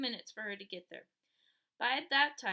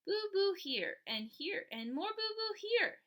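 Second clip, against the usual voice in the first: put-on toddler voice; 9 semitones higher, slower than usual. A narrator's reading. Toddler shows wounds.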